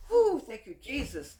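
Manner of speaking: loud whoop of thanks; joyful praise. Real, not acted. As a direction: shout thanks to heaven. Praise exclamation.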